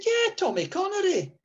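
high Scottish dad voice; lightly voiced Scottish dad; imitating dad. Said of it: "He said".

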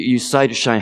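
ordinary talk

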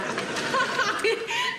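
high amused laugh; sudden giggle, pitched high; burst of laughter. Acted. Sister laughs at book upside down.

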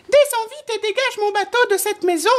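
very high, quick squeaky mouse voice; little mouse crying out. A narrator's reading. Mouse ordering the wind to free his boat, high-pitched cry. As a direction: pipe up fast as a tiny mouse. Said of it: "s'écria le souriceau".

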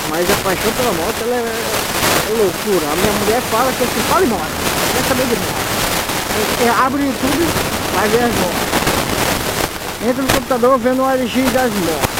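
ordinary talk, nothing marked

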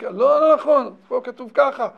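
loud, firm disagreement in a learning debate; insistent objection. Acted. Coachman arguing the text with the rabbi, forcefully. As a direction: push back hard on the rabbi's reading. Heated Torah argument with rabbi.

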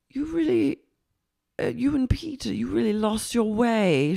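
high, halting concern; put-on pitying boss. Boss confronting waitress gently, high put-on pity. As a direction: pitch it up and hesitate. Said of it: "She goes".

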